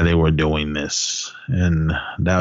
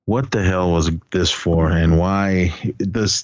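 usual speaking voice

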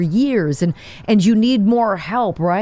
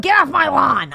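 lightly voiced grumpy grandpa; 5 semitones higher than usual. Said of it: "grumpy grandpa".

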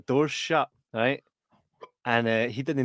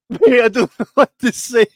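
higher, louder, embarrassed; mock flustered Todd; 10 semitones higher, 11 dB louder than usual. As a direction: play the stunned friend, heightened. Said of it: "And Todd's like".

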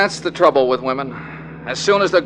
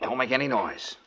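hushed caution; 9 dB quieter than usual. Acted. Detective warning Sonny outside the cabin, in a hushed voice. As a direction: keep it down so nobody inside hears.

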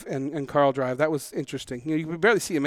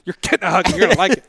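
mock insistence; 3 semitones higher, 8 dB louder, faster than usual. Mockingly echoes the hug threat.

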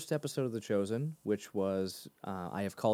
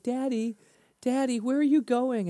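put-on much higher, pleading little child voice; imitating his kids; 13 semitones higher, 5 dB louder than usual. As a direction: squeak it like a whiny little kid calling after dad. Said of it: "they're like".

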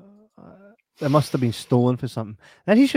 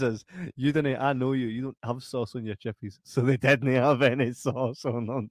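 ordinary delivery

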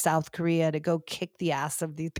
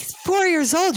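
much higher, louder disbelief; he's only four, can you believe it; incredulous; 11 semitones higher, 5 dB louder than usual. Real, not acted. Protesting to her cohost that the boy is only four, incredulous.

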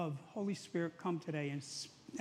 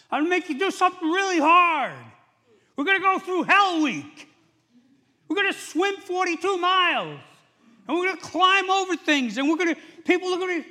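loud, high drill-instructor yelling; shouted tough orders; 13 semitones higher, 13 dB louder than usual. Acted. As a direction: bark out a gruelling challenge to recruits. Mock drill-sergeant version of Jesus' command.

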